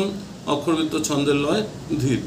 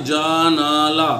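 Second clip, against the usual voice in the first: slow demo; much slower than usual. Shown as a demonstration. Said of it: "জানালাকে যদি আমরা বিশ্লেষণ করি".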